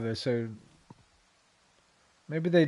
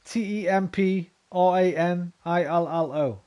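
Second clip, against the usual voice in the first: slow letter-by-letter spelling; slow spelled-out letters; 3 semitones higher, slower than usual. Shown as a demonstration. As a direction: spell each letter out slowly and deliberately.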